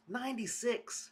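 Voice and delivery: sigh, then say ninety-six high and weary; exasperated sigh. Real, not acted. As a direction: let "Ninety-six." drain out on a heavy sigh. Repeats the heat with sigh.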